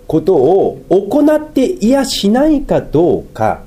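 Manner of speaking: 高めの声でゆっくり一語ずつ; slow it right down and weigh each phrase; slow emphatic. Real, not acted. ゆっくり強調して諭す. (ゆっくりと高めの声で噛みしめるように諭す)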